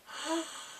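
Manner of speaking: high, quick gasp; shocked gasp. Real, not acted. Viewer reacts to him breaking up, shocked gasp. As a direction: draw a sharp breath in shock.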